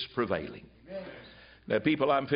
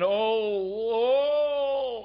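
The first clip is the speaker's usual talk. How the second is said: mock groan of a man under conviction, higher and louder; imitated groaning; 7 semitones higher, 4 dB louder than usual. Retelling a policeman groaning in a flower bed, mock groan. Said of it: "we heard somebody saying".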